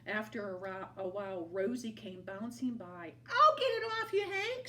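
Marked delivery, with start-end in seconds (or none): 3.3-4.7 very high, loud helpful call; tumbleweed calling to Hank; shouted. A narrator's reading. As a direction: shout it out brightly while bouncing. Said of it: "she shouted".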